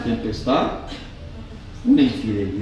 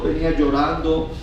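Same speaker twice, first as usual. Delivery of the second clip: ordinary delivery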